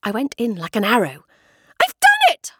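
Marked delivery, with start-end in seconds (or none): 1.8-2.5 much higher, louder triumphant shout; excited cry. A narrator's reading. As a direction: burst out with the victory. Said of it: "I cried".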